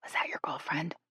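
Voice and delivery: soft whisper into an ear. A narrator's reading. Shay prying at Jill's ear, whispered. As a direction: whisper it privately. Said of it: "whispered against Jill's ear".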